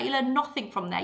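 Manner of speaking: ordinary delivery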